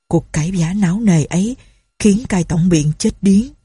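usual speaking voice

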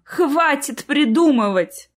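tired exasperation; weary scolding. A narrator's reading. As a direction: scold wearily, stretching the words. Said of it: "устало перебила мама".

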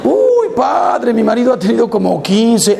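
high-pitched wife pleading with the padre; complaining wife. Acted. A wife tells the padre her husband cheated, high and pleading. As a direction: play the wife, breathless and high, then drop it.

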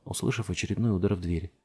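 plain reading voice

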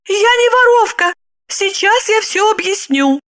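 high-pitched flustered woman's voice; frightened, bewildered. A narrator's reading. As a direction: plead your innocence in a high, flustered voice. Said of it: "Перепуганная Липочка растерянно бормотала". (испуганно и растерянно)

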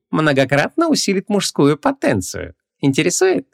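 high, slow salesman's innuendo; suggestive. A narrator's reading. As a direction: offer it with a naughty wink. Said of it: "он фривольно подмигнул".

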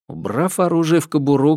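ordinary delivery

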